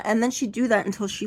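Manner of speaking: ordinary talk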